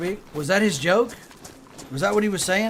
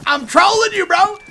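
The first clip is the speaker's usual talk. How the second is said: high, loud shout; gleeful shout; 13 semitones higher, 10 dB louder than usual. Real, not acted. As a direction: yell it out, delighted with the tease. Admits trolling, shouting.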